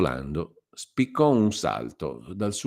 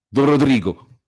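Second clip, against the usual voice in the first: louder, higher, rushed name; blurted in haste; 8 semitones higher, 10 dB louder than usual. A narrator's reading. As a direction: spit the name out and get rid of it. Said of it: "pronunziò in fretta il forzato". (nome buttato fuori in fretta e con paura)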